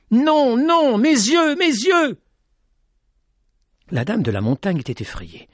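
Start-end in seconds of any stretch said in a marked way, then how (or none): 0.1-2.2 high, loud frightened cry. A narrator's reading. Petit-Jean cries out with the lady, high and loud. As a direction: yell along with her in fear. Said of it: "se mit à crier".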